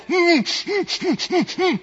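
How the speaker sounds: high, muffled closed-mouth hums; mute humming. Retold or imitated. Struck-dumb Zacharias trying to tell the crowd, in high muffled hums. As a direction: hum urgently with the mouth shut, unable to speak. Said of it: "all he can say is".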